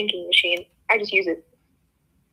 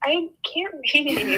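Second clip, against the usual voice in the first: laughing; 6 semitones higher, much slower than usual. Real, not acted. Reader laughs at own misreading.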